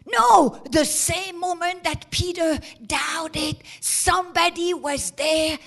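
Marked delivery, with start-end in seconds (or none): 0.1-0.6 sudden loud, high no; shouted no. Real, not acted. Preacher rejecting doubt, shouted. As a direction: cut it off hard.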